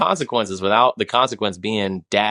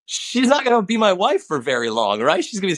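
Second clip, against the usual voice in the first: amused; 10 semitones higher than usual. Real, not acted. Joking about smacking wife.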